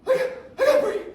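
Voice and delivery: high, loud, broken gasps of a mock panic attack; high-pitched hammed-up panic, can't get the breath. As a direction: act out a panic attack, words breaking off. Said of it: "It's a panic".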